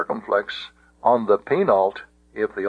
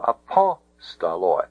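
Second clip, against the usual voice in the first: apostoloi accented on "pos"; stress on third-last syllable; 3 semitones lower than usual. Shown as a demonstration. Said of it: "the accent can go back to".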